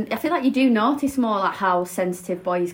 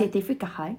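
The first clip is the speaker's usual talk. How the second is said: softer South African impression; South African accent; 5 dB quieter than usual. Shown as a demonstration. Friends swapping their one accent phrase, South African accent. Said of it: "I love the South African".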